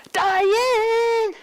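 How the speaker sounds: high, loud call up the stairs; mother's yell. Retold or imitated. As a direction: yell her name like scolding a small child. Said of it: "I don't even know if I can do the tone of voice".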